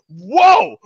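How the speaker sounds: loud, high shocked exclamation; startled whoa. Retold or imitated. Attendant hears he just got divorced, loud startled whoa. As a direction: jump back at unexpected news. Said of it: "And she literally went".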